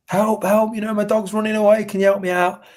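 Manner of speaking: high, pleading cry of a flustered dog owner; imitating a frantic stranger begging for help; mock panicked call for help. Woman chasing her runaway dog, begging strangers for help, high mock panic.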